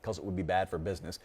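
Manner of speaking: normal talking voice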